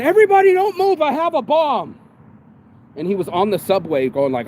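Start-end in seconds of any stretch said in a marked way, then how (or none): 0.0-2.0 high, loud mock threat yell; imitated scream. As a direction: act out a panicked-crowd threat at full pitch. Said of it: "he starts screaming at him".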